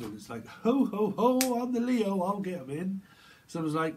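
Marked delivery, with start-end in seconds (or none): 0.5-3.0 loud, high mock ho-ho-ho; boisterous Leo. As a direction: boom it out high as a boastful character. Acts boastful Leo calling out.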